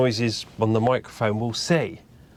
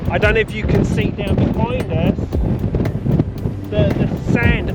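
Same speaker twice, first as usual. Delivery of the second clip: loud, raised voice against the gale; shouting over wind; 6 semitones higher, 4 dB louder than usual. Real, not acted. Filming in insane wind.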